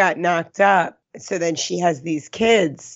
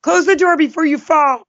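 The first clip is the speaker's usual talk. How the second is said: much higher, raised-voice warning; mock yelling; 9 semitones higher, 4 dB louder than usual. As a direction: shout it like an exasperated son at his mom. Son yelling at drunk mom, acted.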